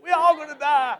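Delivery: high, loud mock panic as the dog; hammed-up doomsday squeal; dog voiced thinking it's Armageddon, high mock panic; panicked dog voice. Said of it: "He's like".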